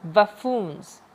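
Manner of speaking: बफून्स with stress on फू; बफून्स, stressing the second syllable फू; stress on FOO. Shown as a demonstration. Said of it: "इसमें फू पे आया". (बफून्स में फू पर ज़ोर)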